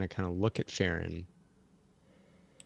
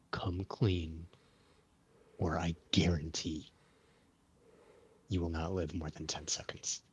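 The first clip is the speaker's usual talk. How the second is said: quiet threat; 2 semitones lower, 7 dB quieter than usual. Acted. Paladin intimidates a prisoner.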